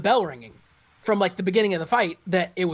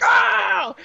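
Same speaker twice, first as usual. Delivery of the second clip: much higher, louder cry of excitement; excited yell; 14 semitones higher, 5 dB louder than usual. Retold or imitated. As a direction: let out a thrilled yelp. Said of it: "I'm like".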